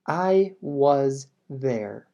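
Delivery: slow, tone drops at the end for finality; falling statement tune. Shown as a demonstration. Teacher demonstrating a statement, slow with a falling tone. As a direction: say it as a firm closed statement, voice falling. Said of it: "Escucha mi tono de voz".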